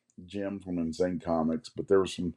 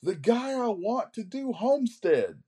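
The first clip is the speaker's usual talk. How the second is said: high, slow mock holler; yelling; slower than usual. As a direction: imitate hollering an explanation to an old friend. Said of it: "they yell at each other".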